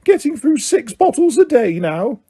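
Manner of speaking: high squeaky elf voice; lightly voiced house elf, hushed; Dobby whispering. A narrator's reading. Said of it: "Dobby whispered to Harry".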